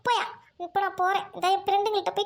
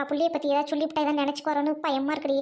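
ordinary delivery

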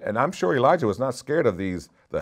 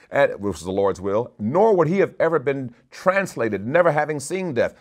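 full-force preaching; 5 semitones higher, 4 dB louder than usual. Acted. Climax of the sermon.

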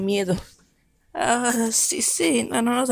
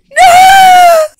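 shout; 15 dB louder than usual. Acted. Dog thrown out.

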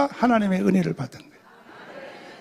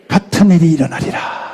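preached shout; 6 semitones lower, 5 dB louder than usual. Acted. Preacher voicing the Spirit's promise.

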